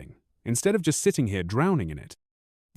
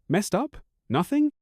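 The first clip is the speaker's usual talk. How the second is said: incredulous echo; 9 semitones higher than usual. A narrator's reading. Husband echoes wife's excuse back, incredulous and rising. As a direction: pitch leaps up in disbelief. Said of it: "I almost laugh".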